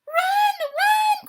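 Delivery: high, loud panicked yell; panicked shout. Acted. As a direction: scream to flee. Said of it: "cried Hedgehog".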